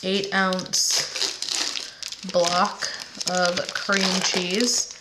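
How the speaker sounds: ordinary delivery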